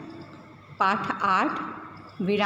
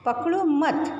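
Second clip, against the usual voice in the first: पकड़ो मत said as one unit, then stop; pitched-up पकड़ो running into मत; pause after मत; 5 semitones higher than usual. Shown as a demonstration. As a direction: keep पकड़ो and मत together, halt after मत. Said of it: "के बाद हम रुकते हैं".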